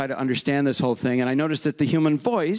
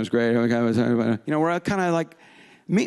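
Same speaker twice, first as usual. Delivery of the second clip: ordinary talk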